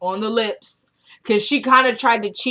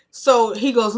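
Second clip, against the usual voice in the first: ordinary talk, nothing marked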